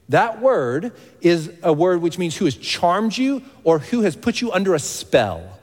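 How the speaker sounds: ordinary delivery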